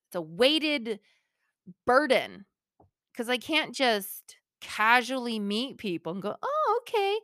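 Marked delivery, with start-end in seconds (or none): 6.4-7.2 high, airy pretend acceptance; put-on polite little reply, pitched up; mock-casual. Host pretending to casually meet people, mock-casual.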